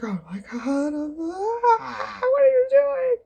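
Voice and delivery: mock horror climbing to a loud squeal; hammed-up shriek of shock; shocked and appalled. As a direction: overact being appalled, voice shooting sky-high. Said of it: "shocked and appalled".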